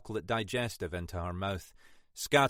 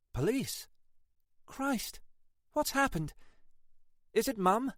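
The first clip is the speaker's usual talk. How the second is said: lightly voiced woman, higher, a touch of alarm; 12 semitones higher than usual. Annabel hears police are calling, lightly voiced worry. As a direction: fear the worst about Mum.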